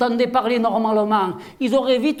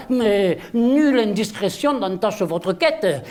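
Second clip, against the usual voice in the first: pompous verse recital; 4 semitones lower than usual. Acted. Mock Molière verse to mayor.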